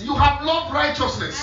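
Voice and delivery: slow, high preaching delivery; emphatic scripture echo. Real, not acted. Preacher repeats the reader's verse to congregation, slow emphatic preaching. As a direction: stretch each word out for the congregation.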